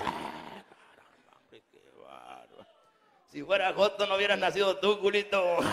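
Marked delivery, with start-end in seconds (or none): 0.0-2.7 low slow dummy grumble. Acted.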